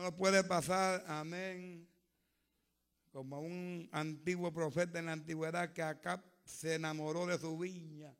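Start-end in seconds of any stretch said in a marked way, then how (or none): none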